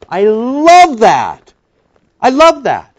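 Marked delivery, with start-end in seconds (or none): 0.1-1.5 very slow, drawn-out, higher; savoured. Real, not acted. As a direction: linger on every word as if tasting it.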